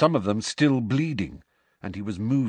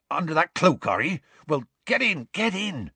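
urgent ushering; 8 semitones higher than usual. A narrator's reading. Hagrid hurries friends inside.

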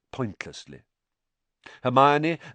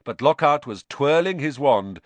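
usual speaking voice

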